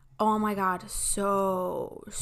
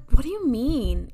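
higher, louder mock indignation; very offended; 4 semitones higher, 5 dB louder than usual. Sister confronting her brother over Thanksgiving, offended. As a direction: demand it like a personal insult. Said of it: "I was very offended".

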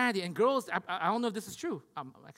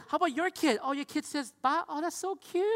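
hammed-up polite interest, pitched up; lightly imitated competitive mom, higher voice; humble-bragging mom; 7 semitones higher than usual. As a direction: coo over another mom's child with mock sweetness. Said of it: "moms give humble brags about their kids".

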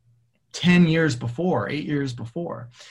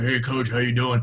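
grown-up voice. Retold or imitated. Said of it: "Your, your voice is up".

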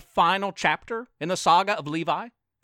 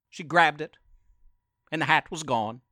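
ordinary delivery, nothing marked

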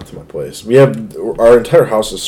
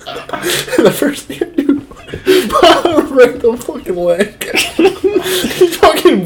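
can barely get the story out for laughing; giggling confession, words slowed by laughs; laughing through it. Real, not acted. Laughing at shooting his brother.

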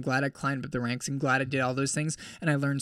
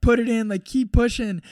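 high, loud coaching yell; yelling encouragement; 7 semitones higher, 5 dB louder than usual. Retold or imitated. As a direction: bark him on to the finish. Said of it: "yelling at me".

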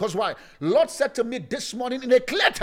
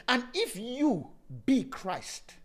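slow, quiet, drawn-out scripture line; slow and softer, savoring the verse; said slowly; 6 dB quieter, much slower than usual. Shown as a demonstration. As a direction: lay each word down slowly. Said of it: "Let me say it slowly".

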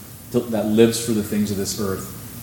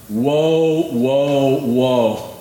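angel crying woe; much slower than usual. Acted. Preacher voices angel yelling.

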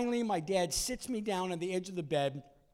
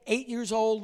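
ordinary talk, nothing marked

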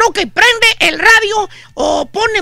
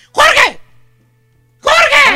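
higher-pitched call from the doorway; mock wife's shout; 7 semitones higher than usual. Wife shouting for her husband from the door, mock yell. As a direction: holler the name like a nagging wife. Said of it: "le grita desde la puerta".

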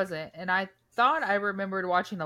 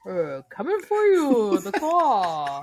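loud, swooping claw-monster voice; hammy, booming dad playing monster; Jerry; 5 dB louder than usual. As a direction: draw it out like a playful menace. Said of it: "he plays Jerry in Liar Liar".

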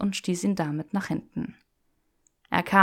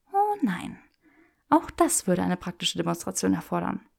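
teasing Hermione voice. Acted. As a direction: tease him with mock refusal. Granger voiced higher.

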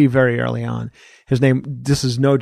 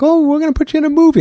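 quick, high-pitched mimicked sales line; put-on high voice, rattled off fast; mock producer pitch; 3 dB louder, faster than usual. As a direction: imitate an eager pitch, rushed and way up high. Voicing the Hollywood producers' pitch.

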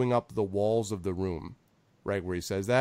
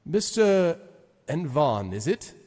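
higher, measured lordly address; lightly voiced Zephyros; 6 semitones higher, 5 dB louder, slower than usual. Zephyros addressing Envon, lightly voiced lord. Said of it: "he says to Envon".

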